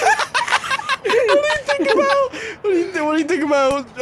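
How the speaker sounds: high-pitched, laughing through words. Real, not acted. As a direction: try to ask but keep cracking up. Breaking up mid-question.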